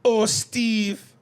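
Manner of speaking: high and loud mock Jamaican "Oh, Steve"; Jamaican accent. Shown as a demonstration. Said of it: "say old Steve again".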